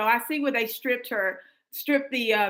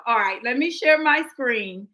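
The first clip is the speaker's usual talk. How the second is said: normal talking voice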